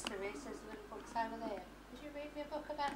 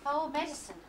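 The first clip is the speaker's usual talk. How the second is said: usual speaking voice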